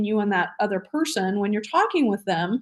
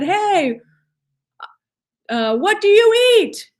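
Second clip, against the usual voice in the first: high, loud frog voice with a wide-open mouth; big mouth frog; 9 semitones higher, 6 dB louder, slower than usual. Retold or imitated. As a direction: stretch every word like a goofy big-mouthed frog. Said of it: "he spoke with his mouth really big".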